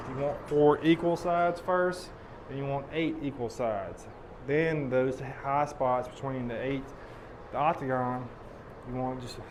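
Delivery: ordinary delivery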